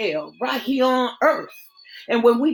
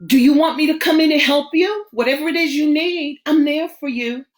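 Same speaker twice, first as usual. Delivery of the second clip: higher, louder, fast and eager; higher, louder rush of an eager offer; 8 semitones higher, 7 dB louder, faster than usual. Acted. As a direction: jump in to help him.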